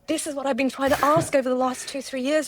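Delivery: high, urgent, half-tearful rush; emotional realisation. Retold or imitated. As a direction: relive the moment the question clicked. Retelling tearful realisation at the show.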